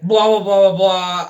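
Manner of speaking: loud dismissive blah blah blah; loud sarcastic filler; mocking blah blah. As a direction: brush the apology off as noise. Dismissive mock of Alice's speech.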